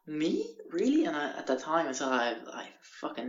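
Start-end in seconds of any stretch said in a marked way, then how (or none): 0.1-1.0 high, rising mock disbelief; hammed-up touched surprise; amazed delight. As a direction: squeak it as if honoured to be asked. Acts his amazed reply.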